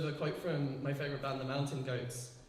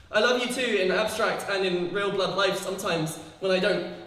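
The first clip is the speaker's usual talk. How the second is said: ordinary talk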